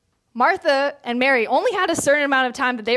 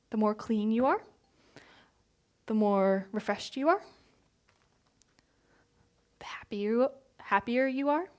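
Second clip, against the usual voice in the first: soft and tender; 7 dB quieter than usual. Retold or imitated. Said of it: "And Jesus is like".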